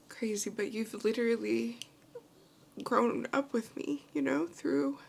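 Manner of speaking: tearful, rising into a cry; happy crying. Real, not acted. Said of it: "why am I crying like this?".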